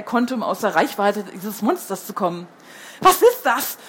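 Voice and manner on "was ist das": stammered in fright, raised and louder; frightened stammer. A narrator's reading. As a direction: blurt it out scared of the monster. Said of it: "stammelte Henry".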